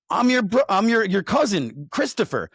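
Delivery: high, loud eager announcement; excited reveal. Retold or imitated. The cousin telling Zach who he is, excited. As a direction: blurt out who you are.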